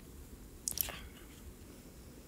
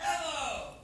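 loud call; 5 semitones higher, 9 dB louder than usual. Real, not acted. Calling out again.

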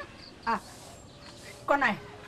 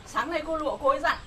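usual speaking voice

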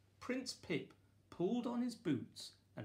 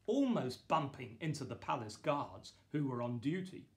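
ordinary delivery, nothing marked

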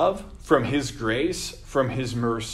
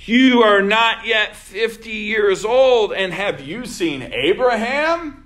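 mocking; 7 semitones higher, 6 dB louder than usual. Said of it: "Kind of mocking him".

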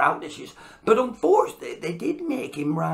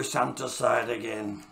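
ordinary delivery

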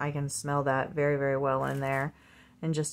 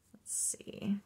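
breathy whispered aside; hushed curious whisper; 10 dB quieter than usual. Real, not acted. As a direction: whisper it to yourself. Whispering to herself.

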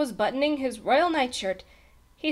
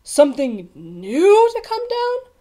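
slow, high, incredulous question; gasped; 7 semitones higher, 8 dB louder than usual. A narrator's reading. Page boy stunned by the king's wish, gasping. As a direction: gasp it, rising high in disbelief. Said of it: "Bartholomew gasped".

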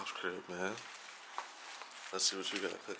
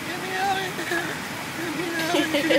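mock panic. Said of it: "this turbulence is crazy".

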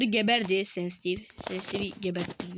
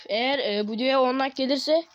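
ordinary talk

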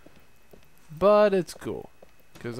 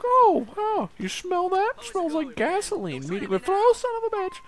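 high squeaky mock voice, much higher; imitating his friend. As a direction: ham up the dopey buddy sniffing gasoline. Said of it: "he just kinda like".

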